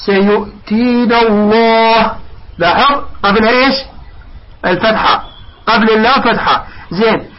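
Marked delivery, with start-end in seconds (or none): 0.1-2.1 slow recitation, "الله" with dark, rounded "lloh"; heavy lam. Shown as a demonstration. Teacher recites example after fatha, heavy drawn-out "الله". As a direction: stretch "سيؤتينا الله", thickening the lam of "الله". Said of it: "اللام نفخمها".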